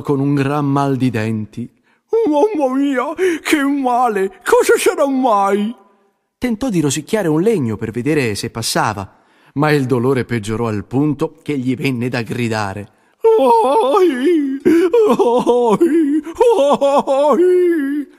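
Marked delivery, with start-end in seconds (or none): 2.3-5.7 high, slow, pained little beaver voice; a young beaver whining at his aching tooth. A narrator's reading. 13.4-18.1 high, louder ai ai ai of pain; disconsolate cries. A narrator's reading. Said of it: "esclamò sconsolato".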